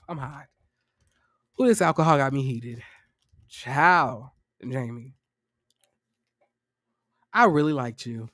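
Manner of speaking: low, soft swooning lament, hamming up the letdown; hammed-up lovesick sighing; mock heartbroken sigh. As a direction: fan yourself and sigh like a let-down admirer. Mock swooning disappointment over Jamie.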